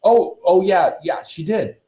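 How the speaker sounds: high, over-eager confirmation; hasty agreement. A narrator's reading. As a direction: back up a friend's fib. Boys hastily back the lie.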